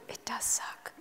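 whispered agreement. Retold or imitated. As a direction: murmur it under the breath like a confession. Boss agrees in hushed aside.

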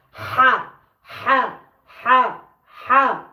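"হা" breathed out from mid-throat; Arabic letter demo. Shown as a demonstration. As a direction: push "হা" out of the middle of the throat. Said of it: "এই হাটা বার হয় মাঝ অংশ থেকে".

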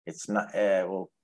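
normal talking voice

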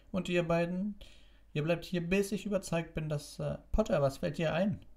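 high-pitched bossy matron, breaking into a scolding; strict matron. Acted. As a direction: order the children about, then rebuke sharply. (strenge Krankenschwester, hoch und tadelnd)

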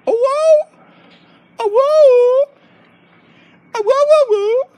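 high, loud sustained howl; mock dog howl. Owner howls along as the happy dog, high mock howl.

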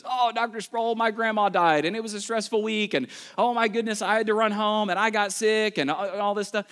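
high pleading whine; mock whiny student making excuses. Whiny students begging professor.

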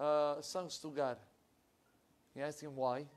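ordinary talk, nothing marked

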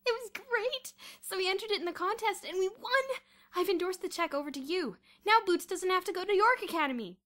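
high, loud, giddy outburst; hysterical. A narrator's reading. Kathy telling how they won the contest, hysterical. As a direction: gush the triumph at full volume. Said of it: "still hysterical".

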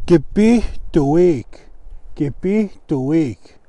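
"kipituik" drawn out slowly with a long ee; slow pronunciation model. Shown as a demonstration. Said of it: "E sound".

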